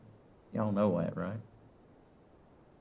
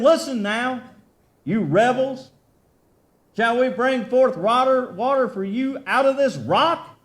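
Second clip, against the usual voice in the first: louder, higher furious rebuke; angry Moses; 9 semitones higher, 6 dB louder than usual. Acted. Moses yelling at the complaining people, furious. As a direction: scold the rebels from the rock.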